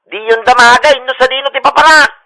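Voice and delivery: very loud megaphone proclamation; blaring megaphone. Acted. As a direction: blast it over the whole street. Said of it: "Sapay nagpigsa mo ti megaphone mo". (napigsa unay nga pukkaw iti megaphone)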